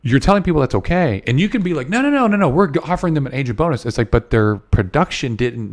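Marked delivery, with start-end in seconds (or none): 1.9-3.8 a high, sing-song imitation of a defensive excuse; mock protest. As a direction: parody someone waving off the accusation, pitched up. Mocking excuse of bribing bosses.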